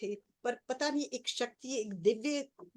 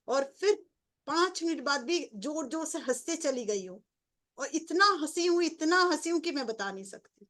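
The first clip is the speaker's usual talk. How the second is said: higher and louder, rushing with wonder; excited reliving; 5 semitones higher, 5 dB louder than usual. Real, not acted. Telling listeners of her laughing fit, excited. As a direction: spill it out, overwhelmed by the memory.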